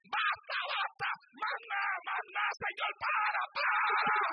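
high, loud cries of stop; desperate shout. Retold or imitated. Preacher reliving the holy presence, screaming enough. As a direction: beg it to stop, overwhelmed. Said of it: "empecé a gritar".

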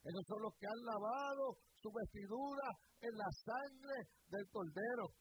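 high, slow, loud preaching; emphatic proclamation. Real, not acted. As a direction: proclaim it to the congregation, stretching the key words. Proclama la respuesta como clímax. (proclamación de predicador, alta y pausada)

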